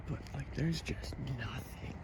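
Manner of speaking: soft spooky whisper. Real, not acted. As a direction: hush it like something's listening. Spooky foggy night walk.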